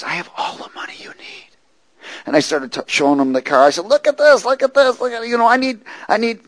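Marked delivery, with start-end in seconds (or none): 0.0-1.5 unvoiced, hushed imitation; whispered quote. Man repeating his offer to the preacher, imitated whisper. 3.9-6.4 high-pitched, insistent imitation; retold urgent plea. Preacher showing his car to the buyer, acted urgent plea.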